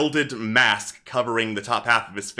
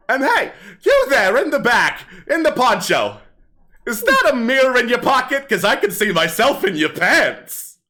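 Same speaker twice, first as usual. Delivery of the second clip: higher, livelier stage patter; kobold comedian; 12 semitones higher, 6 dB louder than usual. Acted. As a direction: work the crowd like a hammy stand-up comic. Kobold heckles the audience.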